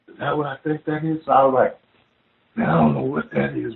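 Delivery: retold hushed alarm; hushed disbelief. Retold stunned exchange at the sighting.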